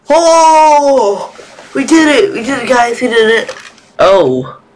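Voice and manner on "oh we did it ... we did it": bursting with relief and joy; triumphant. Real, not acted. Finding the exit.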